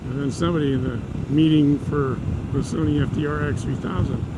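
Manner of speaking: usual speaking voice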